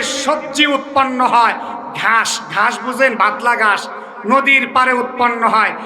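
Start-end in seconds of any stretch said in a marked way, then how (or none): none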